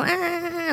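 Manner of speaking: a sharp, high-pitched "Ah" sound. Shown as a demonstration. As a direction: show the wrong sound: a thin, high "Ah". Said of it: "We don't wanna go".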